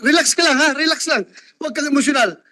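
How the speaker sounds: real high-pitched appeal to relax; urgent calming call. Real, not acted. Chairman calls upset audience member forward.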